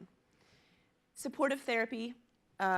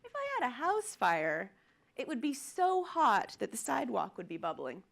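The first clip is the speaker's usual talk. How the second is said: higher, louder, insistent denial; stubborn young woman; 4 semitones higher, 5 dB louder than usual. Retold or imitated. Young woman denying her fire to firefighters, insistent higher voice. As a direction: argue the fire away like it's obvious. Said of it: "And she goes".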